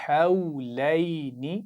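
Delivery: drawn-out, melodic tajweed recitation; slow Quran recitation. Shown as a demonstration. Teacher reciting slowly for pupils to repeat. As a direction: chant each syllable slowly for the class to copy. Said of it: "एक दफ़ा फिर पढ़ेंगे".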